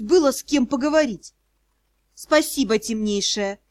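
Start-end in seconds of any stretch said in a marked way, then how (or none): none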